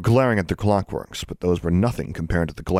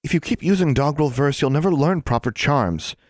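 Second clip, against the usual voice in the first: higher, schoolgirl scolding; 8 semitones higher than usual. A narrator's reading. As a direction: tell your friend off primly. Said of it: "Anna scolded".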